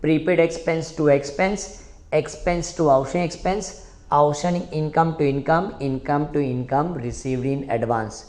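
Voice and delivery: slow, evenly chanted entries; slow chanted memory drill; slow recitation drill. Shown as a demonstration. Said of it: "वापस से try करो".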